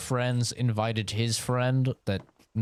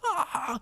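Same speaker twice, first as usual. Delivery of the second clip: mock scream; 14 semitones higher than usual. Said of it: "sleeper cell got activated".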